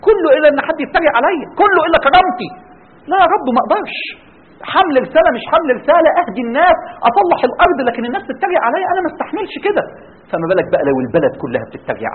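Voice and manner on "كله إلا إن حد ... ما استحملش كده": high-pitched mock protest; indignant refusal. Man refusing the mission over being mocked, indignant protest. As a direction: protest as if your pride is wounded. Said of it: "يقول لك".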